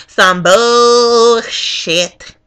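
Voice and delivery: very slow, stretched-out curse; getting mad. Retold or imitated. Voter fed up with the runaround, getting mad. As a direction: drag the swear word right out. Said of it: "Now I'm getting mad".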